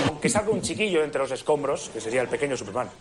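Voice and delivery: usual speaking voice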